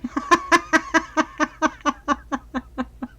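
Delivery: a bright, high burst of laughter at herself; sudden laughing fit; real laugh. Real, not acted. Laughing at herself mid-demo.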